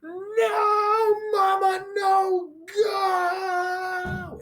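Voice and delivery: very high crying wail; crying toddler. Retold or imitated. Three-year-old at preschool drop-off, crying wail. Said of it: "the three-year-old says".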